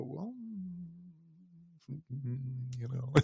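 soft, unsure, trailing hesitation; hesitant mumble. Retold or imitated. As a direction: stall quietly for an answer. Friend caught out, hesitating.

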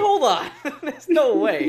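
laughing in disbelief; cracking up mid-sentence. Real, not acted. Laughing disbelief at teammate's age.

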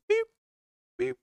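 short imitated bleep; mock censor beep. Vlogger addressing YouTube mods, mock censor beeps. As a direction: pretend to bleep yourself out, in tiny beeps.

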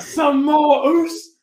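high, loud wrestler taunt; mock taunt. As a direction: yell it like a hammed-up wrestler. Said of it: "He's like".

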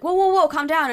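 imitated calm down; mock calming. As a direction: wave her down, mock alarm. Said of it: "And you're like".

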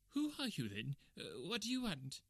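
very high, frightened little man; startled, timid, high-pitched questioning; quavering voice. Acted. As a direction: ask nervously in a high quaver. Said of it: "he asked in a quavering voice".

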